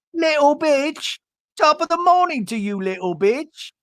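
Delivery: high, loud, silly caricature voice; mock taunting squawk. Tamara taunting someone, high loud mocking squawk. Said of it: "And then Tamara goes".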